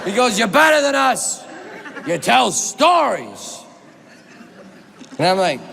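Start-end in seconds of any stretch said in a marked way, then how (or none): 0.4-3.6 high shout slowing into drawn-out words; hammed-up movie-star proclamation; Al Pacino. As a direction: start high, then stretch the last phrase grandly. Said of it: "Al Pacino says".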